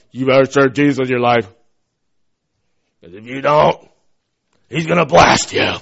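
imitating a threatening elder in a put-on voice; mock stern warning. Parent warning children to serve Jesus or else, mock menacing.

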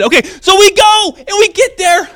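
high shouting rush; excited shepherd. Retold or imitated. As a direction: burst out with the story. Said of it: "they're so incredibly excited".

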